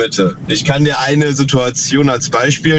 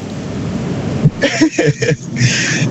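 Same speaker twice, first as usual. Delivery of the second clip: an airy, voiceless chuckle; breathy laugh. Real, not acted. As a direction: laugh under your breath. Caller laughs at host's reaction.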